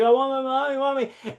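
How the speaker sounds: put-on high, whining little-kid voice; imitating a crying boy begging for mommy; whiny crying boy. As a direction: mimic a toddler wailing for his mom. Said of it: "And he's like".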